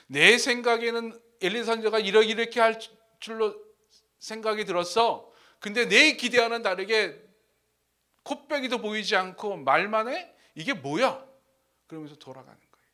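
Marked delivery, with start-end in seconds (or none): 0.1-11.3 loud acted grievance; angry. Preacher plays Naaman complaining about Elisha, in mock anger. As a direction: protest indignantly, as if let down. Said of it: "나아만이 화를 내면서".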